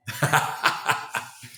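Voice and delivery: short unvoiced laugh; breathy laugh. Real, not acted. Laughing at own disbelief.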